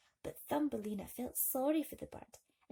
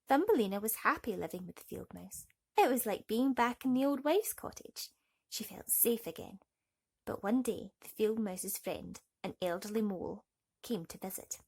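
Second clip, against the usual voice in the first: ordinary delivery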